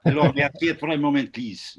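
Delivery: put-on playful Belgian accent on request. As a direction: drop into a light Belgian accent. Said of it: "anybody wanna give us a little Belgian?".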